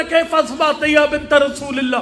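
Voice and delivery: urgent, grief-laden pulpit climax; impassioned lament preaching. Real, not acted. Preacher recounts the injured daughter's answer, full-force lament. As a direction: drive the mourners toward tears.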